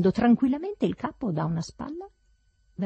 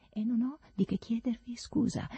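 soft, higher, unruffled reply; unperturbed; 5 semitones higher, 5 dB quieter than usual. A narrator's reading. Servant ignores master's shouts, unperturbed. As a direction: carry on as if nobody had shouted. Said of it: "come se non avesse notato affatto le mie grida".

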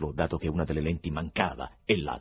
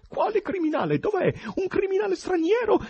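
very high, loud excited questions; animated yell; 6 dB louder than usual. A narrator's reading. Choirmaster springs up at news of a criminal, animated yell. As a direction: shriek the questions in eager alarm. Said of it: "balzò in piedi e urlò".

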